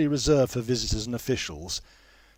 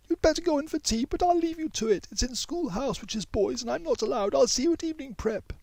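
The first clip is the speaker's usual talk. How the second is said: put-on much higher, racing girl's voice; gabbling; faster than usual. A narrator's reading. Mei Yi flees from Jonathan, fast gabbling girl voice. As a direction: rush it all out to escape. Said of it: "she gabbles".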